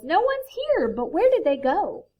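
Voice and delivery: high, loud storybook exclamation; crying out. A narrator's reading. Bear searching the empty tree, crying out. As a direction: cry it out, puzzled. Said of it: "Bear cries".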